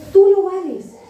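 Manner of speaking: loud, high mock commercial voice; L'Oreal ad slogan. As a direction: belt it out like a shampoo ad. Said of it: "la publicidad del, de un shampoo ahí, de L'Oreal". (eslogan publicitario imitado, alto y fuerte)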